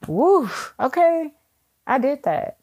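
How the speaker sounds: high, slow relieved whoop after a good cry; relieved woo. Retold or imitated. To listeners, after a good cry, a high relieved whoop. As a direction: let it all out with a big high woo. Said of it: "after you're like".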